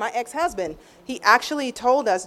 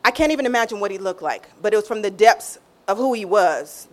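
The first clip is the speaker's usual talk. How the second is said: ordinary talk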